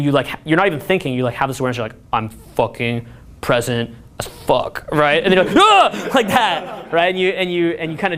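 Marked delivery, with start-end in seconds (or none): 2.1-4.9 spaced-out calm. Retold or imitated. First time very present, meditation. 5.6-6.1 sudden high loud yelp; startled high yelp. Retold or imitated. Meditator snapping out of presence, startled high yelp. Jolted out of the zone.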